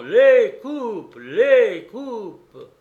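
loud, high chanted demand; crowd shouting. A narrator's reading. Soldiers greeting the general, all shouting for the cups. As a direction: shout it like a clamouring mob. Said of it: "tous criant".

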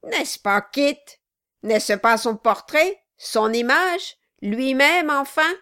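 the dwarf jeers as he beats the figurehead; loud taunting cries at the wooden head; Quilp crying out. Acted. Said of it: "cria le nain".

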